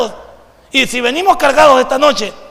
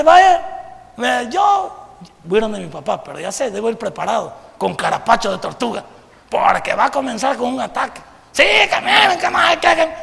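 hammed-up shout of an angry dad; father's attack. Father launching an attack on his son, hammed-up yell.

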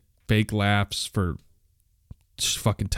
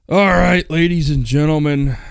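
loud, raised show-host sign-off; announcer call; 6 semitones higher, 7 dB louder than usual. Pretend. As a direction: call out to the crowd, high and loud.

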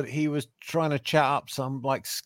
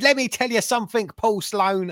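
much higher, fast mock promo shout; shouty wrestling promo; 10 semitones higher than usual. Wrestler calling out Paul Sloan, a higher fast mock shout. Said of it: "a full-on shouty".

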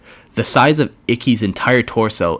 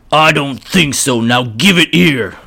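a brutish orc taking his time; 5 semitones higher, 3 dB louder than usual. Acted. Orc voiced.

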